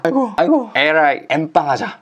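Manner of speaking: high-pitched mock cry of exasperation; put-on, high-voiced student outburst; exclaiming. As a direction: cry it out like a fed-up friend at the bill. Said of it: "이렇게 외칩니다".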